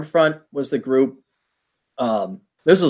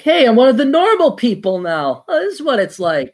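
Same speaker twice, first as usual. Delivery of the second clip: mock delight; 9 semitones higher, 3 dB louder than usual. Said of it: "And it's like".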